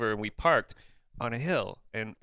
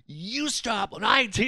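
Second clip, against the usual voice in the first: higher, louder, slow furious rant; losing it; 9 semitones higher, 4 dB louder, slower than usual. Retold or imitated. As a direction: tour manager losing his temper at the driver. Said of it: "and he starts losing it".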